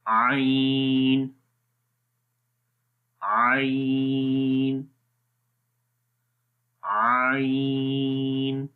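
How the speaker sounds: long slow chanted ain from the throat; drawn-out Arabic letter ain. Shown as a demonstration. Teacher demonstrating the letter ain to students, drawn out. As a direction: stretch ain slowly like a recitation teacher. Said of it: "अब इसकी मिसालें पढ़ते हैं".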